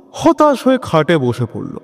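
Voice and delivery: ordinary talk, nothing marked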